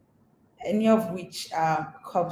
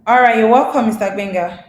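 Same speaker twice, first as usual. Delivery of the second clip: calling out; 2 semitones higher, 10 dB louder, faster than usual. Real, not acted. Host greeting guest on call.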